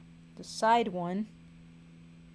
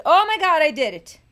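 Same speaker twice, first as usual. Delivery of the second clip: high, loud triumphant cry; excited shout; 7 semitones higher, 12 dB louder, faster than usual. Real, not acted. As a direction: celebrate finishing at full voice. Finishes the drawing, triumphant.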